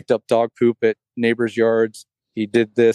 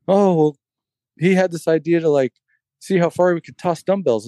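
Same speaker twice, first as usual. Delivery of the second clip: higher, lightly voiced kid making excuses; son's sheepish excuse; 6 semitones higher than usual. Dad imitating his son's excuse, higher kid voice. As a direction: play the guilty son explaining, pitched up.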